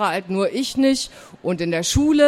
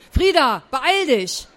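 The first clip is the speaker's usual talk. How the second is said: higher-pitched, mildly cross shout; slightly angry call; 4 semitones higher, slower than usual. Acted. Aunt hurries her niece from outside, slightly angry. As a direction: call out with a touch of annoyance. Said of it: "klang jetzt ein bisschen wütend".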